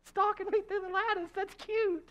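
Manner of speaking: put-on high, dreamy girlish voice; gushing infatuated girl. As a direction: gush like a smitten bride.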